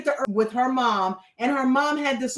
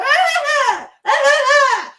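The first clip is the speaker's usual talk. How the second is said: loud, high mock bawling; hammed-up wail in two long bursts; mock crying wail; 9 dB louder than usual. Mocking the dad crying on the show, mock crying wail. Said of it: "He was crying like a".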